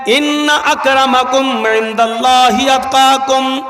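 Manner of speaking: slow intoned Arabic verse; slow Quranic recitation. Acted. Preacher quoting scripture, slow solemn recitation. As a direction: draw the verse out reverently.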